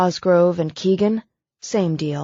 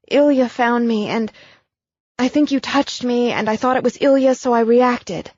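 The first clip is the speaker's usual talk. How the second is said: higher, rushing, upset woman's voice; anxious apology; 6 semitones higher than usual. Acted. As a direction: tumble the explanation out in distress. Abigail explains, upset.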